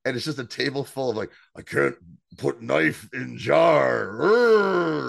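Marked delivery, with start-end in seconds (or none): 1.6-5.1 loud strained caveman growl, halting words; mock dim-witted struggle. Host mimicking Mick failing at ants on a log, strained growl. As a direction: labour through each word, ending in a growl. Mocking character's clumsy struggle.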